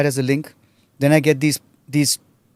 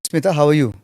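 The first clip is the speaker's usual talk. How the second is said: ordinary talk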